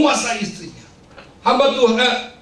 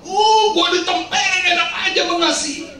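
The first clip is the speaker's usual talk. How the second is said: high-pitched mock outrage; hammed-up indignation, pitched up; indignant wife; 8 semitones higher than usual. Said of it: "Dia memikirkan".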